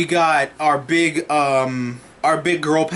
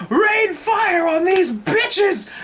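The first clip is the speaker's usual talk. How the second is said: much higher, slow and drawn out, mock tyrant; hammed-up villain command; much slower than usual. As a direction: bellow the order like a cartoon warlord. Thanos orders the attack.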